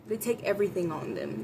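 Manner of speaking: ordinary delivery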